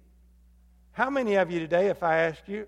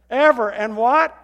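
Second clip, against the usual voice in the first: "Ever" pushed louder, rising prompt; emphatic prompt; 4 semitones higher, 8 dB louder than usual. Shown as a demonstration. Pastor urging the congregation to answer, louder. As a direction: call it out louder to draw the answer. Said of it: "That's an emphasis".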